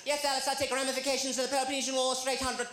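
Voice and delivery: high, fast know-it-all quiz voice; smug Jeopardy contestant. Retold or imitated. Comic mimics a Jeopardy player to the crowd, high and fast. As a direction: rattle it off like a smug brainiac. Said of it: "they're like".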